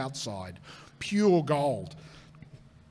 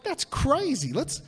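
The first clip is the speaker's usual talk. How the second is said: excited; 9 semitones higher, slower than usual. Retold or imitated. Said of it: "So we're like".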